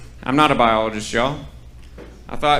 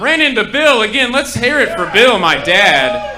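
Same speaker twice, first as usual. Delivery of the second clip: louder, higher emcee shout-out; hyping the crowd; 6 semitones higher, 6 dB louder than usual. Real, not acted. Comic calls on the audience to cheer his dad, loud and high. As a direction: whip up the room for Bill.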